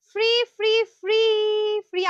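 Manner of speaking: high, loud repeated prize call; excited hype. Real, not acted. Host hyping the winner's free dress, excited shout. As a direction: sing out each word like a hawker.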